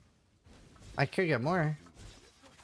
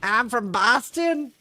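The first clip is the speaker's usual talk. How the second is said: slow, higher put-on Boston accent; 5 semitones higher, much slower than usual. Streamer answering a friend in a mock Boston accent. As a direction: drawl it like a Bostonian. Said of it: "No, that's Boston?".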